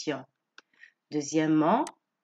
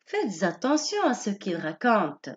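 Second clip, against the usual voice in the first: usual speaking voice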